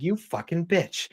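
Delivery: high, drawn-out mock cursing; mock resentment. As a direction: curse softly as an outbid contestant, hammed up. Imagined rival bidder cursing the outbidder.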